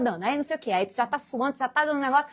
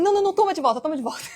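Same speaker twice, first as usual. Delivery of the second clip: desperate pleading; 4 semitones higher, 3 dB louder, much faster than usual. Retold or imitated. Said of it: "um desesperado vai falar assim".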